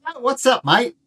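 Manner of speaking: loud, high hammed-up Australian; badly faked Aussie greeting; terrible Australian accent. Zookeeper answers a visitor, bad Australian accent. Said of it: "My terrible Australian accent".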